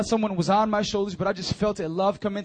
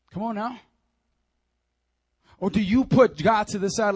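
ordinary talk

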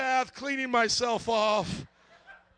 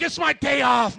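raised, loud complaint; indignant protest; 5 semitones higher, 9 dB louder than usual. Retold or imitated. Husband pleading his day off to his wife, loud indignant protest. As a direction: protest at full voice.

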